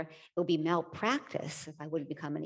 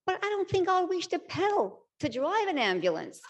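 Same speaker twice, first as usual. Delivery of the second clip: high-pitched, emphatic objection; retold worried protest; 12 semitones higher, 4 dB louder than usual. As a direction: voice your own doubt, pitched well up. Retells her own worried protest.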